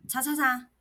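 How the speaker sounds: demanding, furious summons; confronting a cheating boyfriend, loud and sharp; sharp call-out. Retold or imitated.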